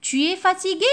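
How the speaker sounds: high loud questioning tune; model question. Shown as a demonstration. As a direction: ask it like a question to a pupil. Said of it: "Regardez la réponse".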